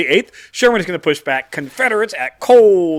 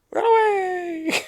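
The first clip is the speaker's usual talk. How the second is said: high, slow squeaky run-away cry; mock panicked cry; much slower than usual. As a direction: squeal it like fleeing cowards. Mock cry of fleeing troops.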